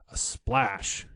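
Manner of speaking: ordinary delivery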